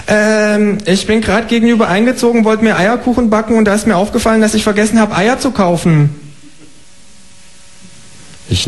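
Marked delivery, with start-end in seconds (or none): 0.1-6.1 high, chirpy kangaroo asking for eggs. Acted. Kangaroo asking the neighbour for eggs, high and chirpy. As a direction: pipe up like a polite cheeky kangaroo.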